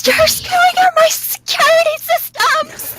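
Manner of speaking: very high, slow panicked shout from far away; distant shout. Acted. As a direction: yell it across the ship. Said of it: "Nick shouting in the distance".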